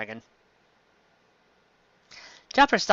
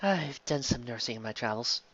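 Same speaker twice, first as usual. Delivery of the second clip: Robin, softly amused; quiet smirk; 10 dB quieter than usual. Acted. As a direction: murmur it with a knowing smile.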